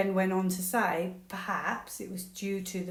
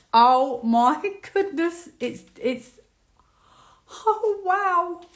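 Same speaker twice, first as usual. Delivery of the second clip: slow, high, loud gasp with a laugh; amazed and laughing; 10 semitones higher, 10 dB louder, much slower than usual. Real, not acted. Reader stunned by her own reading, breathless laughing amazement. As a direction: let the amazement spill out in a laugh.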